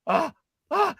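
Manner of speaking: short high "ah"s; imitated high cries. Boy seeing Doug at the bar, imitated high cries. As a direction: mimic his worked-up little cries.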